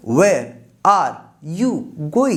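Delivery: each word split off by a clear pause; teacher spelling out a question. Shown as a demonstration. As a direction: dictate it slowly, one word at a time. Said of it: "teacher dictates word by word".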